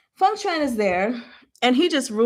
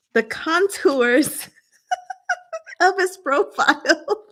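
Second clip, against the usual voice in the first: high-pitched laughter breaking into the reading; laughing uncontrollably; 8 semitones higher, slower than usual. Real, not acted. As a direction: read slowly through a fit of giggles. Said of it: "I can't stop laughing".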